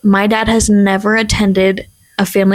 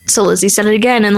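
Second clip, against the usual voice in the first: ordinary talk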